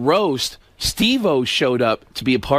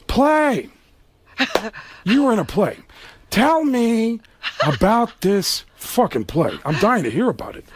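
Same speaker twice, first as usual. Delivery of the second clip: mock-innocent higher Pacino; Al Pacino impression; 5 semitones higher than usual. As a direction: play dumb, then drag out the sarcasm. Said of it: "he goes".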